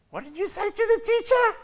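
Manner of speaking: high, loud mock-scold; mother grilling her young child, high mock scold; scolding mom. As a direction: voice the embarrassed mother's shriek.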